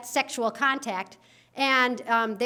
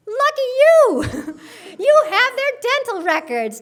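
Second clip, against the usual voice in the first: sarcastic; 11 semitones higher, 10 dB louder than usual. Pretend. Sarcastic punchline.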